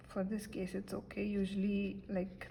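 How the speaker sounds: normal talking voice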